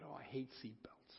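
breathy unvoiced whine about seatbelts; whispered grumble. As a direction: mutter it like a sulky kid. Teller voices self in retold talk.

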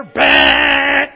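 loud mock horn, like a game-show buzzer; car horn blast. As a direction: blast it like an impatient driver's horn. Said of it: "like a Family Feud buzzer".

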